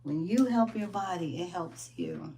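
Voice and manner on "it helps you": soft echo. Real, not acted. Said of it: "I can't even say that enough".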